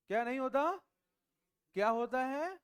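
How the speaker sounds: high, loud questions thrown at the class; loud quizzing. Real, not acted. Teacher demanding an answer from students, loud and high. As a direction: call out to the whole room.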